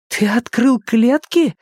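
high, slow, astonished question; trembling amazement. A narrator's reading. Nina learns the cages were opened, trembling with amazement. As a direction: ask it in stunned disbelief, voice way up high. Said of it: "Голос Нины дрожал от изумления".

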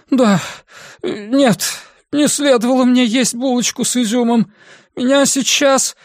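high, queasy woman's voice; about to be sick; nauseous woman. Acted. Andrea nauseous.

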